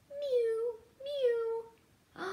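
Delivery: mew, mew, high and small; high kitten mew. Acted. Reading to children, high little kitten mews. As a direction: squeak it like a tiny kitten. Cat sound read aloud.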